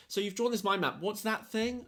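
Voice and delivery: high-pitched mock questioning; needling teacher imitation. Retold teacher picking at the student.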